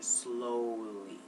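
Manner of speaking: drawn-out slowly. Shown as a demonstration. Drawing out the word to illustrate it.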